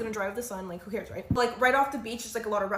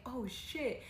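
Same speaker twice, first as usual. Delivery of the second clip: quiet; 2 semitones higher, 9 dB quieter than usual. Retold or imitated. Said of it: "And I was like".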